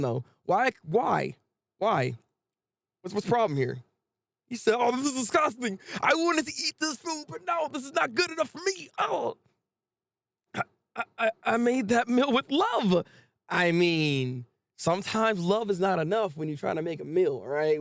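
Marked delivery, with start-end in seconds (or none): 4.8-9.4 high, whiny complaint; mock disgusted diner. 10.5-13.0 lightly voiced wounded cook, higher pitch; stammer, then draw out the wounded protest; mock hurt cook. Acting the hurt cook's reply.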